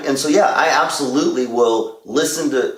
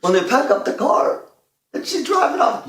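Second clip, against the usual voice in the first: mock choked-up; 13 semitones higher than usual. Dad describing the car commercial that makes him cry, mock choked-up. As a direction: voice climbing to a high, tearful squeak. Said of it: "when you start crying at commercials".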